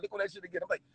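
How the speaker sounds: ordinary talk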